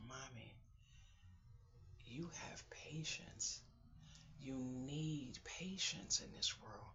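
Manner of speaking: put-on hushed and slow, a child's gentle advice; soft whisper. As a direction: whisper the line tenderly and slowly. Quoting daughter's gentle advice.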